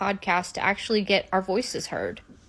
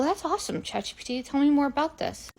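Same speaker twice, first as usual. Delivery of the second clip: mock gushing; 5 semitones higher, 6 dB quieter than usual. Crystal gushing to AI.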